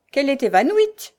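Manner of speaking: loud, high grumble; grumbling. A narrator's reading. Shrew concludes the girl has fainted, grumbling. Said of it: "grommela la mégère".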